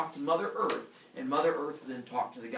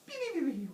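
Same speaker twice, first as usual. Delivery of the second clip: high little vanishing sound effect; rapid cartoon zaps, pitched way up; magic zap noises; much faster than usual. Teller showing Hera turning invisible, high zappy noises.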